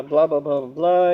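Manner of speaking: mock rambling on camera, run-on blahs; fast filler babble. Shown as a demonstration. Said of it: "speaking kind of fast and I say".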